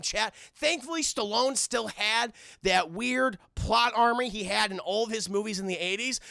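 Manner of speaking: usual speaking voice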